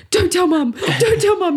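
urgent pleading. Retold or imitated. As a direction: beg fast, half panicked, half giggling. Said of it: "were like".

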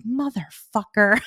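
slow, drawn-out curse; comically defeated swearing; mock exasperation. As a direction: stretch the swear out, half amused. Retold reaction to being seen crying.

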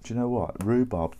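tasting coffee, he finds rhubarb in his new beans, delighted; excited discovery. Real, not acted.

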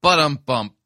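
loud drum-sting sound; rimshot. Shown as a demonstration. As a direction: mimic a rimshot. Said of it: "rimshot after the joke".

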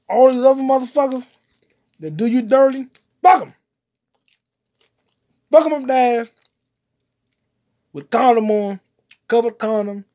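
loud, high ranting yell. Real, not acted. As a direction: shout it out in a rant. Ranting loudly about backstabbers.

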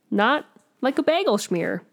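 normal talking voice

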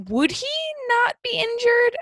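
very high-pitched, incredulous question; baffled disbelief. Retold or imitated. Host questioning the unhurt bully's fall, baffled disbelief. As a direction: climb into a squeaky protest.

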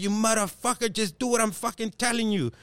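high-pitched imitation of an exasperated scammer; pissed-off caller. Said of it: "they get all pissed".

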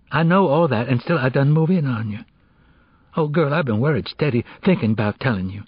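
put-on pleading teenage friend. Girl confessing she moved in on friend's crush, pleading. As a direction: explain yourself urgently to your friend.

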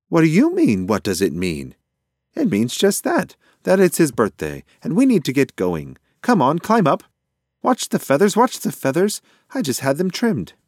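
high, loud, bossy bird voice; fussy pigeon. A narrator's reading. Pigeon tells mouse to climb aboard carefully, fussy and loud.

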